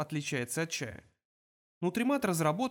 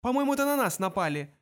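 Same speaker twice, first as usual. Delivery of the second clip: high, raised, worried objection; alarmed retort; 7 semitones higher, 4 dB louder than usual. A narrator's reading. Arthur sees missiles attacking the ship, alarmed retort. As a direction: correct him in alarm, pitched up.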